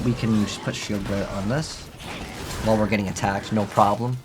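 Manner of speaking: ordinary talk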